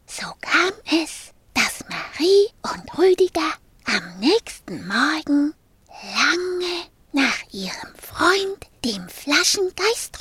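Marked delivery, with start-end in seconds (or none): none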